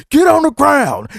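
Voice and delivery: high, loud arrest command; mock police shout. Police yelling at suspect stepping outside, loud command. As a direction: bark it like a cop making an arrest.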